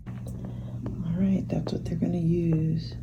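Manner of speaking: unvoiced hushed aside; quiet whispered explanation of the needles. Real, not acted. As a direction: whisper it to the camera. Whispering about syringes.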